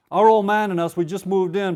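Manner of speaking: put-on Cajun accent. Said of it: "I get to do my Cajun accent".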